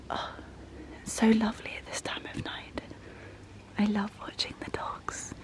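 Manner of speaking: soft whisper to the dogs at night. Real, not acted. Whispering to the dogs on a late night walk. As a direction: hush so you don't disturb the night.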